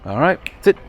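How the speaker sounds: bright, firm sit command; dog command voice. Real, not acted. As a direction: call the sit up high and clear.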